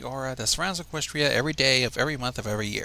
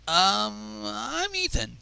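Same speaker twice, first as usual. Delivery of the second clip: nervous mumble; 7 semitones higher, much slower than usual. Acted. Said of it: "he mumbled nervously".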